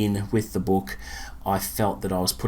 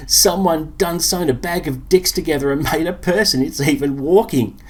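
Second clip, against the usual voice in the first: high, loud cheeky wisecrack; Wayne voice; 8 semitones higher, 5 dB louder than usual. Acted. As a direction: taunt it with cocky glee. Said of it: "Wayne said".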